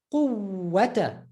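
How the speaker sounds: Quwwata with a long held ww; emphasized waw. Shown as a demonstration. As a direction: hold the uww in Quwwata before -ata. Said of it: "we're just emphasizing the waw".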